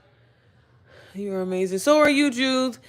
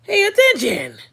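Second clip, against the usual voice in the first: high, loud sassy "pay attention"; mock scolding; 13 semitones higher, 6 dB louder, slower than usual. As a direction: snap at careless fans, playfully. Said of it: "I said".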